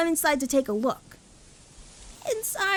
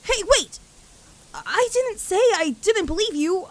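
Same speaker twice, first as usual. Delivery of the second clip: loud protest; 5 semitones higher, 4 dB louder than usual. Acted. Rainbow Dash protesting quickly.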